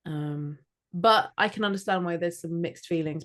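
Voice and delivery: ordinary talk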